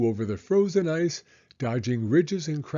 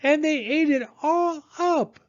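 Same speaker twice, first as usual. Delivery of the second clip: put-on high squeaky baby bear; 5 dB louder than usual. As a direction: sputter it like a tiny upset cub. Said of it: "in her high squeaky voice".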